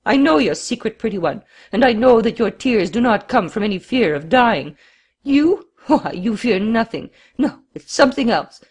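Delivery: frantic, high, loud outburst; frenzied exclamation. A narrator's reading. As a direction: burst out in wild accusation. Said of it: "suddenly seized with a sort of frenzy, he rose to his feet and exclaimed".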